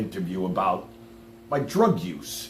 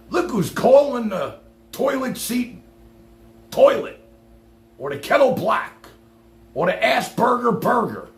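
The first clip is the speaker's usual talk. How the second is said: mock comeback; 10 semitones higher, 4 dB louder than usual. Jumbled comeback to the interviewer.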